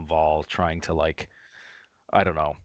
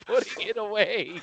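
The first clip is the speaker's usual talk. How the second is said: normal talking voice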